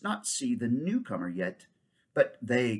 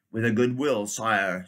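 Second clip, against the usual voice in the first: louder, ready centaur; willing centaur; 7 dB louder than usual. Acted. Centaur promptly accepting the king's command. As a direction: agree at once.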